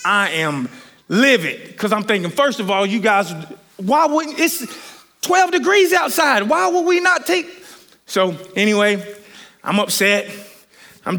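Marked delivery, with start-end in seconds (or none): 3.8-7.5 rising, higher pitched outrage; indignant, voice climbing up; furious. Real, not acted. Preacher to congregation, retelling the hotels refusing, livid. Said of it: "So I'm furious".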